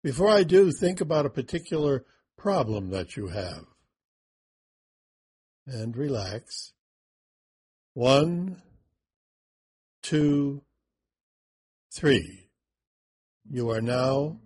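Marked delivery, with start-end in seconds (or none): 5.7-14.4 slow hypnotic count; guided meditation. Acted. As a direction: count them down calmly. Counting into the door.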